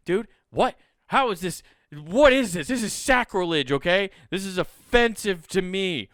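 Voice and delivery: mock-offended rant about a wrestling snub; mock outrage. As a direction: play it as a big overblown grievance. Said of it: "I'm angry".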